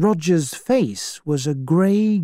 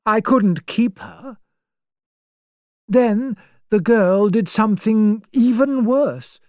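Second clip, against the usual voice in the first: put-on pitched-up, prim old spinster; a righteous spinster passing judgment; self-righteous old lady; 7 semitones higher than usual. Emily lectures Vera on crime and punishment.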